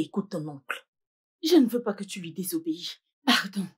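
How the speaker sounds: usual speaking voice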